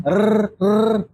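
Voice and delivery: "rr" rolled on the tongue; repeated trill on RR. Shown as a demonstration. Teacher showing that ra repeats, rolled R. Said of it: "suka gini".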